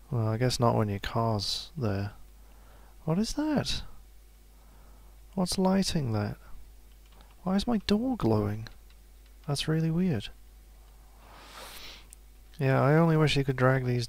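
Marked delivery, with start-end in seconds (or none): 3.0-10.3 high, puzzled and startled; suddenly baffled by a strange glow; surprised. Real, not acted. Sees his door glowing at night.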